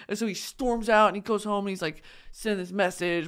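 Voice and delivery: plain reading voice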